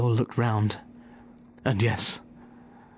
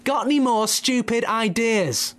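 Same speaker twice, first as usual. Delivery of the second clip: "Got any more stupid ideas?" yelled high and loud at a deaf man; much higher shout, as if to a deaf ear; shouted back; 12 semitones higher, 3 dB louder than usual. Acted. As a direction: bellow it so a deaf man hears. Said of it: "Bunce shouted back".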